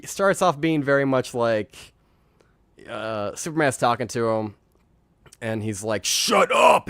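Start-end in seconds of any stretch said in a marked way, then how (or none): 6.0-6.9 high-pitched mock shout; retold angry shout. Podcaster retelling a character cutting off Superman, mock angry shout. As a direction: act out a sudden shouted outburst.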